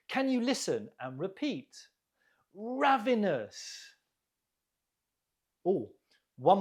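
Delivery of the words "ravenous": RAV stressed high in ravenous, then falling; stress on first syllable. Shown as a demonstration. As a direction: model ravenous slowly, leaning on the first syllable. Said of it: "stress on the first syllable".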